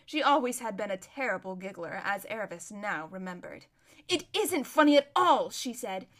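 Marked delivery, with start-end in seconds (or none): 4.1-5.5 loud, raised-pitch reproach; urgent protest; indignant. Acted. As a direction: scold a giggling friend. Runaway scolds giggling friend.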